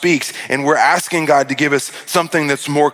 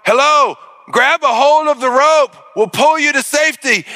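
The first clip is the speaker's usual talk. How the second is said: megaphone call; 8 semitones higher than usual. Acted. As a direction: call down from the helicopter speaker. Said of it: "and through the speaker".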